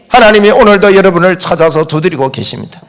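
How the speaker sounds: ordinary talk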